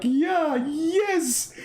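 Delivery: very high giddy mock voice; hammed-up excited worshipper; excited mosque crowd. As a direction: squeal with glee like an overexcited worshipper. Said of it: "The people in the mosque like".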